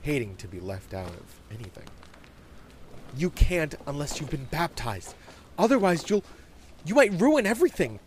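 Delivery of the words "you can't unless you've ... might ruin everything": put-on high kid voice growing louder; agitated child laying down the rule; objecting young boy. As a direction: warn her off anxiously.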